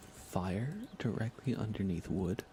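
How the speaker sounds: ordinary delivery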